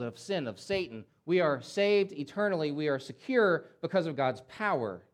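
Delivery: ordinary talk, nothing marked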